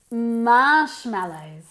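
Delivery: "Marshmallows" with a long, loud MARSH; stress on first syllable. Shown as a demonstration. Teacher models marshmallows for students, first syllable stressed. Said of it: "Emphasis on the first syllable".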